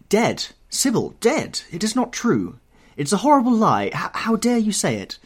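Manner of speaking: high, loud outburst of shocked denial; anguished cry. A narrator's reading. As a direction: cry out in pain and outrage. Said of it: "A cry of pain broke from the lad's lips".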